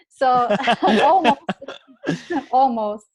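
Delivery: laughing through the words; breaking into laughter mid-sentence. Real, not acted. Laughs at near birth abroad.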